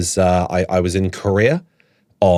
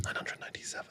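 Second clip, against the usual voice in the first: barely audible whispered count; hushed, private mutter; muttered under breath; 25 dB quieter, faster than usual. Retold or imitated. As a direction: whisper it to yourself while counting stock. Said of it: "muttering it under his breath".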